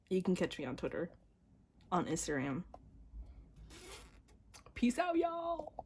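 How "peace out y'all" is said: much higher, slow sing-song; high drawn-out peace out; sing-song signoff. Real, not acted. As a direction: sign off playfully, stretched. Signoff sung out.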